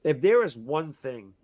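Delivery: ordinary talk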